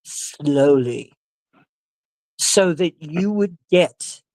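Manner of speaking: word by word, stretched out; slow, deliberate. Shown as a demonstration. Showing how the preacher spoke, slow and deliberate. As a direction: draw each word out so it lands. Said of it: "she spoke slowly".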